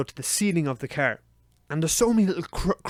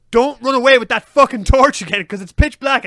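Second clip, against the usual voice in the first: raised, loud, pleading order; exasperated warning; 7 semitones higher, 9 dB louder than usual. Retold or imitated. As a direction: tell them off urgently. Scolding partner about torch.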